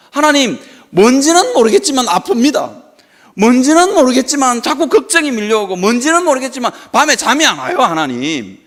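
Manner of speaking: impassioned cry that drops away at the end; pleading prayer. Acted. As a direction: pour out your troubles in prayer. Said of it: "자기 문제를 다 이렇게 오픈하고".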